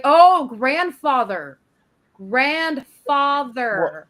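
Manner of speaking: "grandfather" stretched very slow, dawning surprise; "Oh, grandfather" drawn out slowly as understanding dawns; slow realization. Retold or imitated. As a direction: drag out "grandfather" as it finally clicks. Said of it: "I was like".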